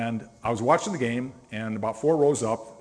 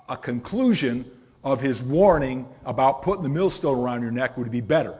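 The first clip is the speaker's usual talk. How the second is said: ordinary talk, nothing marked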